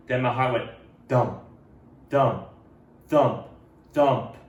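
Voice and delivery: each "thump" punched and falling; heartbeat thumps. A narrator's reading. Narrating Ralph's pounding heart, punched "thump"s. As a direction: beat out every "thump" like a heart.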